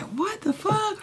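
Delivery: high, stunned what the fuck; mother reacting to DCF news; shocked disbelief. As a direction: blurt it out in disbelief. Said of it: "So I'm like".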